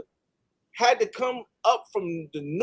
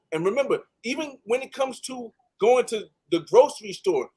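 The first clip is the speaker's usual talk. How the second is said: normal talking voice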